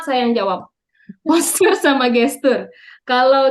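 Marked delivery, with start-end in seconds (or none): none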